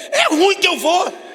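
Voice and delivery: hammed-up frightened refusal; mock refusal. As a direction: shout it like no way. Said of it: "Eu falei, eu?".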